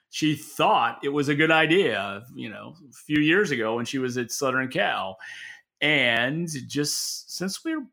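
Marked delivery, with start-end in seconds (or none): none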